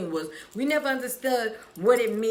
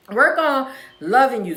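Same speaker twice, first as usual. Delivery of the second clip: louder, punchy rally call of "Work"; louder repeat; 4 semitones higher, 11 dB louder than usual. Shown as a demonstration. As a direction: hit "Work" hard for the crowd. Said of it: "Work on it for yourself Wednesday".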